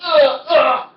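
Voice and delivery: high strained grunts of toppling backwards; demonstrated falling grunts. Shown as a demonstration. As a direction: act out losing balance with two grunts. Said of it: "let me show you what it is".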